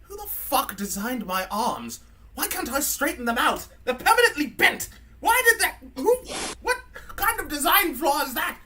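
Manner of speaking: put-on indignant high-pitched drunken British robot rant; mean drunk British robot; drunk C-3PO. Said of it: "First thing he says is like".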